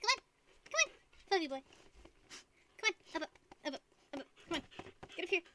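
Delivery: high coaxing call to the dog; gently urging the dog to jump up. Real, not acted. Owner coaxes her husky onto the bed, high and encouraging.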